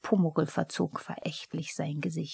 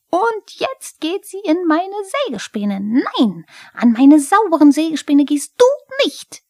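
much higher, louder outraged kobold cry; indignant outcry; 11 semitones higher, 12 dB louder than usual. A narrator's reading. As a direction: shriek in protest to guard his sawdust. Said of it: "Pumuckl schrie empört auf".

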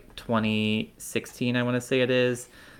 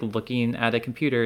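usual speaking voice

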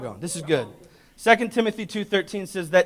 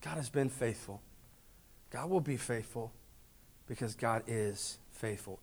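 tender reassurance; 10 semitones lower, 22 dB quieter than usual. Acted. Say to your spouse.

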